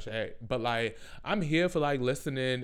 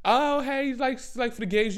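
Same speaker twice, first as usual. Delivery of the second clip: high, louder squeal of a greeting; hammed-up party girl saying hi; mock drunk bar girl; 12 semitones higher, 5 dB louder than usual. Mocking the drunk girl at the bar who greets too loudly. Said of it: "who just be like".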